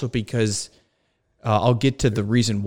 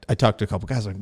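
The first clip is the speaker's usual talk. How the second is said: normal talking voice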